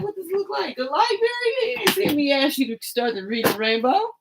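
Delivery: high, disbelieving comeback; incredulous retort. Retold or imitated. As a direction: scoff at her in a high voice. Mocking retort to reader.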